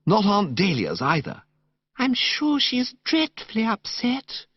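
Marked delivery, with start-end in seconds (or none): 2.0-4.5 put-on high, breathless girlish gush, slowly savoured; gushing, dreamy young woman. Narrator voicing Madeline to Bertie in the park, high dreamy gush. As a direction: coo it like a sentimental girl.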